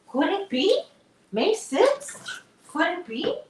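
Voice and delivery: very high, eager asking; excited hope. Real, not acted. Owner hoping it is a non-accident day, very high excited voice. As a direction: ask the dog with eager excitement.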